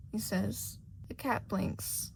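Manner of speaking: ordinary delivery, nothing marked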